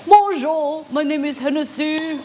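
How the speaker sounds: high, loud put-on French accent; mock French accent. Shown as a demonstration. Host testing if Hennessy sounds French, mock French accent. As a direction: introduce yourself as a hammed-up Frenchman. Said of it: "Sounds more French".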